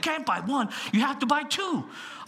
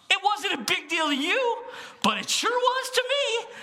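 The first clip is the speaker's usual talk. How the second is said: rising emphatic; 11 semitones higher than usual. Real, not acted. Preacher stressing personal importance.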